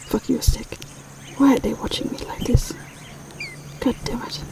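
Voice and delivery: hushed cranky muttering; whispered bird grumble. Pretend. Vlogger whispering the magpie's cranky thoughts.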